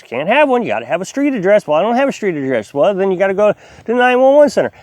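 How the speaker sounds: normal talking voice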